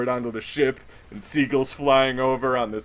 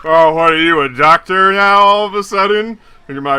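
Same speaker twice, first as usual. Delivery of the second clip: put-on louder, higher drunk retort; drunk challenge; 4 semitones higher, 9 dB louder than usual. Drunk Chad mocking the doctor, loud. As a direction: scoff at him like a drunk.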